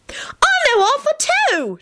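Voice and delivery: put-on very high, drawn-out child's cry; excited yell. A narrator's reading. Timothy tells Alex he knows Arthur, excited yell. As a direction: call it out slowly in squeaky excitement. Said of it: "Timothy yelled".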